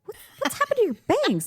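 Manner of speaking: high-pitched mock astonishment; alarmed mom. Mom spots her kid's ruined bangs, alarmed. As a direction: squawk it like a startled mother.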